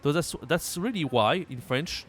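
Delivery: usual speaking voice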